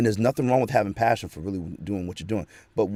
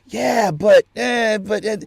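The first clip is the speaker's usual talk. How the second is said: higher, louder hesitant yeah but; hesitant reaction; 12 semitones higher, 6 dB louder, slower than usual. Retold or imitated. Person unsure about God's plan, hesitant. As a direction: stall and waver. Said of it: "your reaction is like".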